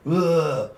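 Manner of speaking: exaggerated ugh; disgusted ugh. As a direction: scoff with an exasperated ugh. Mock disgust after blame quote.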